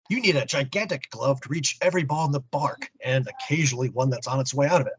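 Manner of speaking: usual speaking voice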